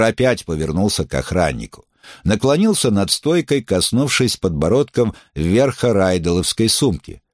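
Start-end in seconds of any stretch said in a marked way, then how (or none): none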